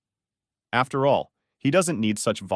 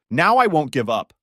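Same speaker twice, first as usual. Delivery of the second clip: louder, higher exclaimed vow; determined exclamation; 4 semitones higher, 7 dB louder than usual. A narrator's reading. Boy vowing to the heavens, loud exclamation. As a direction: exclaim it with raised voice. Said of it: "the boy exclaimed".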